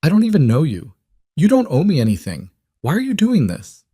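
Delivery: pushing back, rattled and rising; rising, shaking voice. A narrator's reading. Clara to Elias, refusing his money, voice rising and shaking. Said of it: "Her voice was rising, shaking".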